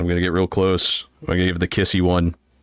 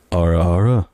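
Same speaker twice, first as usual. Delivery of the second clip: louder, sultry "Ara, ara" with a lowered pitch; teasing ara ara; 4 semitones lower, 5 dB louder than usual. Pretend. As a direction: lean in and purr "Ara, ara" to the listener. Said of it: "Is that better?".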